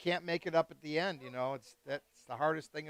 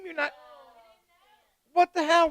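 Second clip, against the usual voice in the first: loud, high disbelief; loud, high stunned outburst; incredulous; 9 semitones higher, 8 dB louder than usual. Real, not acted. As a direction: sound truly shocked. Shocked the caller skips the game.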